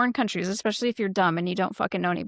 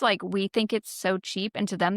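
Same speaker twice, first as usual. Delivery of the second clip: ordinary talk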